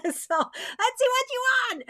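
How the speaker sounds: laughing. Real, not acted. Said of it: "Oh, I don't wanna be a witchy witch".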